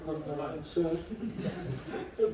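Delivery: barely audible, low mumbled greeting; wrong timid greeting. Shown as a demonstration. Coach showing students how not to greet the interviewer, timid and very soft. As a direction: demonstrate a meek, far too soft hello. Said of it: "ये नहीं कि".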